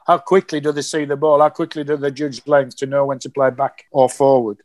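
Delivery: normal talking voice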